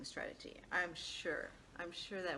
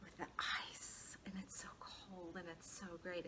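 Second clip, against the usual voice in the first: dreamy whisper; 7 dB quieter than usual. Retold or imitated. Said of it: "describing it to me".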